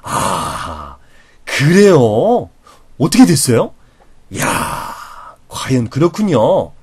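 slow, attentive listener reactions; varied responses. Shown as a demonstration. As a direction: show fascination with each new reply. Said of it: "계속 똑같은 말만 하는 게 아니라 바꿔줘야".

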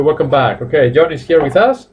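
usual speaking voice

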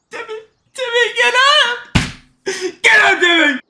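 high, loud cry to a downed friend; urgent call. Real, not acted. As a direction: yell for him to get up. Calling downed teammate.

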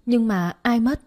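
acted neighbour's startled outcry; alarmed question. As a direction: ask in shocked alarm. Neighbour alarmed by supposed death.

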